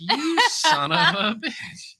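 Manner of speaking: high, drawn-out curse breaking into laughter; mock outrage. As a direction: curse in hammed-up indignation, laughing. Retold curse at cancelling driver.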